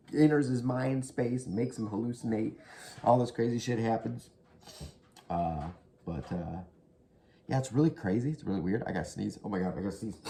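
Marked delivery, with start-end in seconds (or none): none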